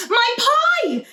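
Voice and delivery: high, loud cry of discovery; excited exclamation. A narrator's reading. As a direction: cry out, remembering the pie. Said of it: "exclaimed Amelia Bedelia".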